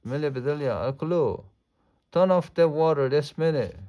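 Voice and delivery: storybook outrage rising to a shout; angry order. A narrator's reading. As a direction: scold them and demand it at once. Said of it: "She was very angry".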